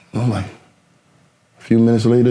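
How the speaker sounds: normal talking voice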